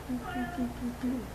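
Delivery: tiny high, soft pew noises; imitated gun pews. Host imitating the gun firing, high soft pews. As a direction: make little high-pitched laser-like pews. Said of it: "when you shoot 'em, it goes".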